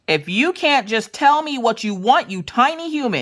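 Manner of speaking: high, mock-fed-up scolding; exasperated parent. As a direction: scold a child in comic frustration.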